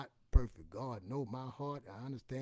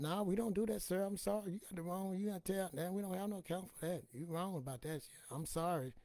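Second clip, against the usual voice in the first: higher, prim shop clerk voice; store lady; 6 semitones higher than usual. Retold or imitated. Comic as store clerk refusing the account. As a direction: politely turn him away. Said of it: "Lady said".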